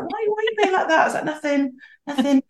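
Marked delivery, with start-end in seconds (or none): 0.0-1.0 squeaky, very high mock husband; husband voiced in a high silly squeak; puzzled husband. Husband asks why she's tense. 1.2-2.4 high, quick, cagey "nothing, nothing"; mock-innocent deflecting; evasive denial. She hides nervousness from husband.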